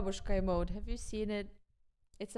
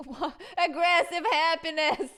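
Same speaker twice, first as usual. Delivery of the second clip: louder, laughing through the words; 6 semitones higher, 6 dB louder than usual. Real, not acted. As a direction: burst out laughing while speaking. Laughing at the music.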